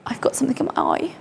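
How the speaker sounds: breathy whispered aside. Real, not acted. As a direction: whisper it so only the camera hears. Aside while filming.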